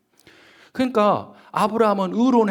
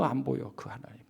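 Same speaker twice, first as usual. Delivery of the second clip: whispered aside; 3 semitones lower, 9 dB quieter than usual. Real, not acted. Preacher to congregation, whispering God's absence. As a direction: breathe it out in a whisper.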